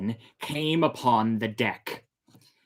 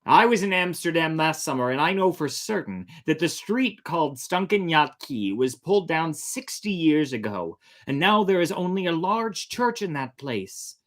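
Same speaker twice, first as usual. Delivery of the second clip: louder, higher, firm; raised voice; 7 semitones higher, 5 dB louder than usual. Acted. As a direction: raise your voice to be heard across the deck. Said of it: "Tom Willis raised his voice".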